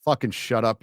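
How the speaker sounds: ordinary talk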